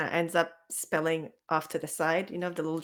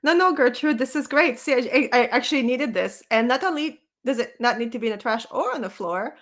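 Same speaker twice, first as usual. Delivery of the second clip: higher, louder, bright delight; eager enthusiasm; 6 semitones higher, 8 dB louder than usual. Acted. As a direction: gush that this is great. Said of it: "Parrish is gonna be like".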